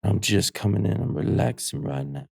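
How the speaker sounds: sexy voice note. Pretend. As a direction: play a tired smooth charmer. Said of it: "Can I do mine?".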